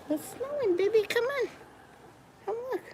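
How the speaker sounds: high soft cooing. Real, not acted. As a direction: coo to the cat quietly. Calling cat, high soft.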